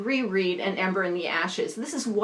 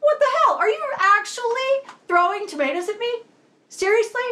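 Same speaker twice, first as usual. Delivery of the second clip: high, loud incredulous yelp; shocked shouting disbelief; startled outrage; 13 semitones higher, 5 dB louder than usual. Retold or imitated. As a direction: shout it like you just got hit in the back with a tomato. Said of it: "he's like".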